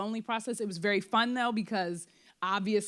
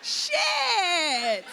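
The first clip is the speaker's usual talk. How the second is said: startled outburst; 9 semitones higher, 6 dB louder than usual. Real, not acted. Sudden outburst, cry shout.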